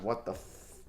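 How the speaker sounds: a whispered curse of disbelief; stunned whisper. Real, not acted. Gamer watching his team choke, stunned whisper. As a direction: breathe it out, barely voiced. Team choking in game seven.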